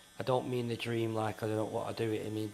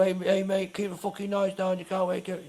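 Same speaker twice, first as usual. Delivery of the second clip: put-on higher, louder barking at noisy teens; mock angry shout; 7 semitones higher, 5 dB louder than usual. Security guard mock-yelling at noisy teenagers, loud angry shout. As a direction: shout them down like an aggressive bouncer. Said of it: "But we're not gonna".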